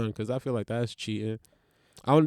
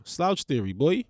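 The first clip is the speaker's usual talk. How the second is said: ordinary delivery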